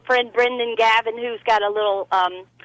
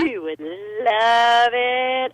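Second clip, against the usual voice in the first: very slow, stretched "LOVE"; gushing, drawn out; much slower than usual. Real, not acted. Urging Donna to watch the show, slow gushing emphasis. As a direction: draw "love" right out with relish.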